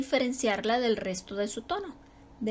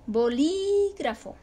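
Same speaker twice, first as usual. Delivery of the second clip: Bolígrafo slow, with LÍ held high; teacher's drawn-out high LÍ in Bolígrafo; stress on LÍ; 4 semitones higher, slower than usual. Shown as a demonstration. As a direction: stretch LÍ way out in Bolígrafo. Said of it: "también tenemos el acento ortográfico".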